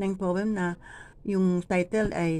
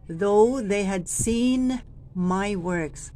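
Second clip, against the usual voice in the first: very slow, falling on seen; slow down and drop on seen; slow falling inflection; 2 semitones higher, much slower than usual. Shown as a demonstration. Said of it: "Sabay tingin po sa tao".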